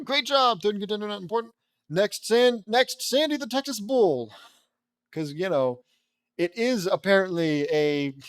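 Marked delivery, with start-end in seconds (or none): 0.0-4.3 high, hyped-up announcer voice; excited announcer. Acted. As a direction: belt out the next contender's introduction.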